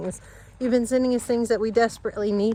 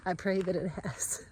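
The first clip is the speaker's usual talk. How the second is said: hushed, breathy hope under the breath; a hopeful whisper to herself; whispered prayer; 8 dB quieter than usual. Real, not acted. Hopeful prayer about the delivery.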